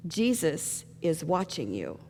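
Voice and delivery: low, hushed, slow warning; mysterious warning voice. Acted. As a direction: play an unseen voice warning softly. Mysterious voice warns burglar again.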